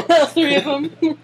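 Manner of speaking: real amused laughter breaking through; laughing. Real, not acted. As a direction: giggle out the words in disbelief. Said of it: "laughing at all Papa".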